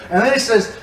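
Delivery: ordinary delivery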